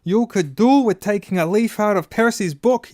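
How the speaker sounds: loud, high angry yell; furious yell. A narrator's reading. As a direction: jab the words at him as she shouts. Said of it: "yelled Missus Weasley".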